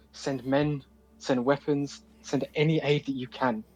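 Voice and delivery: soft, halting plea for aid; hushed desperate appeal; pleading paladin. Acted. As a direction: beg quietly, one request at a time. Player voices Gabriel pleading.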